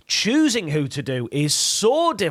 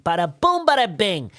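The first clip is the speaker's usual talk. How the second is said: put-on New York accent; 2 semitones higher than usual. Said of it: "easiest New York accent is your Joe Pesci".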